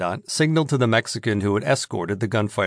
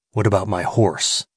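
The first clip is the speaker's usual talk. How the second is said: hint of menace; 3 semitones lower than usual. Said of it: "just a hint of menace in his voice".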